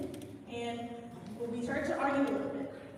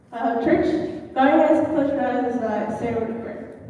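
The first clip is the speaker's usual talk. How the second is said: louder call to bow heads; calling out to church; 10 dB louder than usual. Real, not acted. Youth speaker calling the church to prayer, louder call. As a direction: raise the voice to gather the congregation.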